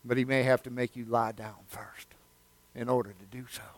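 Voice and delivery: gentle hushed reassurance; whisper. Acted. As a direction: confide it quietly. Drops into whisper.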